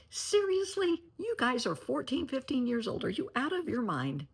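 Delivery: put-on exasperated at teenage trick-or-treaters at her door; incredulous exclamation. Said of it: "It's like".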